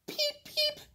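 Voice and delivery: tiny squeaky peeping chick; very high, thin baby bird peeps. Acted. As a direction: peep like a little chick inside the egg. Egg answers.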